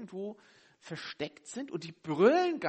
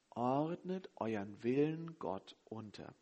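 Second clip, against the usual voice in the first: softer and slow, letting each word sink in; soft slow repeat; 6 semitones lower, 5 dB quieter, slower than usual. Shown as a demonstration. Preacher repeating the key verse to the congregation, soft and slow. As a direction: repeat it gently and deliberately, as the key point. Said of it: "das ist das Entscheidende".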